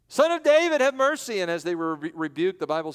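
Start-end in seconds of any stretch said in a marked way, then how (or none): 0.1-1.4 much higher and loud, pleading; desperate crying out. Acted. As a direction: cry out for mercy. Blind men cry out again.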